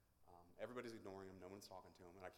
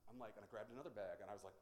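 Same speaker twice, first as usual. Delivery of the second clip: normal talking voice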